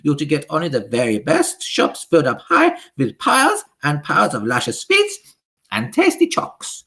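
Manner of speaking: high, loud witch voice with a foreign lilt; high imperious witch decree; Grand High Witch. Acted. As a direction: command the witches like a tyrant queen.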